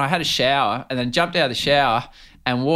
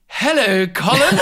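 higher, louder posh "Hello, Colin."; mock English accent; 10 semitones higher, 4 dB louder, slower than usual. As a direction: greet him like a stiff English presenter. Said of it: "I'm like".

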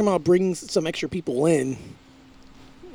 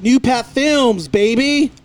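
tipsy and giggly; 6 semitones higher, 4 dB louder, much slower than usual. Real, not acted. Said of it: "Sorry, I'm a little tipsy right now".

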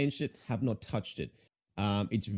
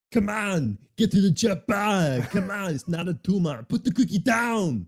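put-on loud, high Austrian action-hero accent; Arnold Schwarzenegger impression; 10 semitones higher, 8 dB louder than usual. Shouting Austrian accent at the streamer, hammed up. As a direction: bark it like a hammy Austrian action star. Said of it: "He sounds French ... is it Austrian".

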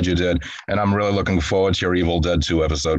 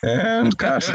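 laughing; 12 semitones higher than usual. Real, not acted. Ending recording, laughing.

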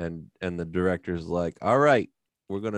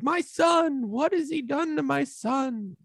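much higher anguished cry; grieving father's yell; slower than usual. Retold or imitated. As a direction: wail for the lost son. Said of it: "he yells like".

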